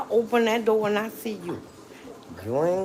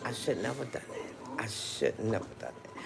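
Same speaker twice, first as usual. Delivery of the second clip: soft, low, mock-sorry mumble; a hint of hammed-up remorse; mock regretful; 10 semitones lower, 14 dB quieter than usual. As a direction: play the sorry ex muttering regret to himself. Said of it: "and then they be like".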